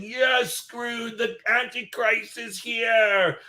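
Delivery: high, slow possessed voice; possessed demon. Retold or imitated. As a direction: drag out the words like something possessed. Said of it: "I could see him going".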